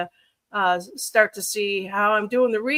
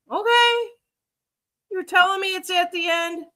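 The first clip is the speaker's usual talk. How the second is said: incredulous; 10 semitones higher than usual. Real, not acted. Reacting to a statement in disbelief.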